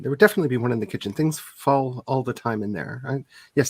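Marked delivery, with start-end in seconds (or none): 0.0-1.1 rushed. Acted. Repeated, rushed.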